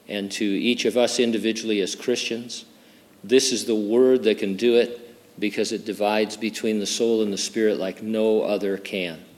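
soft, earnest opening prayer. Real, not acted. Pastor leading the congregation in prayer, soft and earnest. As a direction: pray quietly and reverently.

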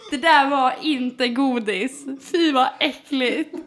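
slow, laughing mock disgust. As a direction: laugh while acting grossed out. Retelling Saga's disgust, laughing.

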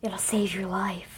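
slow, hushed whisper. Retold or imitated. Retelling the officer's line from the film, slow hushed whisper. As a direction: whisper it slowly like a secret warning.

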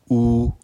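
OU held high and perfectly level; first tone on OU. Shown as a demonstration. Teacher showing learners Mandarin OU, high level tone. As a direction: say OU long, high and even. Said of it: "Premier ton".

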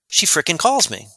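normal talking voice

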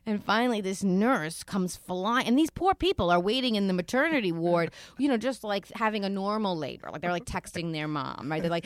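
ordinary delivery

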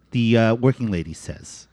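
ordinary delivery

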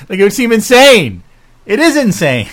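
incredulous outburst. Real, not acted. Exclaiming at the absurd price.

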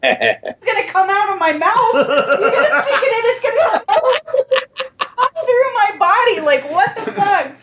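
laughing mock horror. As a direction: act appalled through a laugh. Retold panic at the probe.

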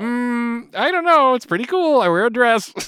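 put-on high, loud eager kid greeting; thirteen-year-old kid. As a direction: greet like an excited young teen. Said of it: "I'm thirteen-year-old [name]".

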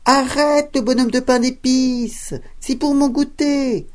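high, loud shout of the chasing wife; old woman shouting to stop the cookie. Acted. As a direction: yell after him while running. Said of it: "en criant".

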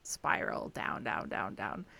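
very low, sinking voice; low, trailing descent into gloom; spiraling downward. Shown as a demonstration. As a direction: let each "down" sink to the bottom of the voice. Acts out spiraling down.

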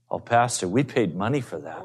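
lightly voiced drunk couple begging to keep their liquor; pleading drunk. Drunk couple protests pouring out liquor.

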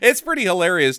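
usual speaking voice

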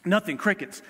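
normal talking voice

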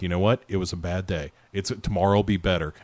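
low, soft retold reassurance; gently forgiving. Mom lets dad off the hook, gently reassuring. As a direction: let them off the hook quietly. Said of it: "she lets him off the hook so easy".

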